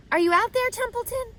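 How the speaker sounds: Wilbur calls out high and loud; calling out. A narrator's reading. Pig calling for Templeton across the yard, high and loud. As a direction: call across the wet yard for the rat. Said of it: "called Wilbur".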